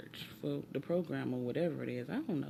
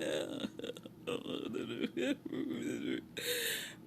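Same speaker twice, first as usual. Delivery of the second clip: hammed-up sobbing; mock boohooing; 9 semitones higher, 4 dB quieter than usual. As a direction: imitate bursting into boohoo tears. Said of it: "I just start booing".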